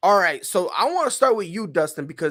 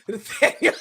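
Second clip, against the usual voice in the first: ordinary talk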